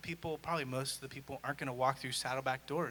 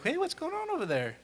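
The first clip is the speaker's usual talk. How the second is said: curious excitement; 5 dB louder than usual. Retold or imitated. Student spotting a crowd.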